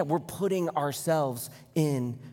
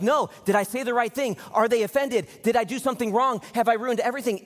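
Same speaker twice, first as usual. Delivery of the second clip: higher-pitched string of worried questions, lightly acted; mock anxious second-guessing; 6 semitones higher than usual. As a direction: act out fretting over each question. Voicing someone's anxious second-guessing.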